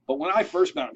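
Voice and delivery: normal talking voice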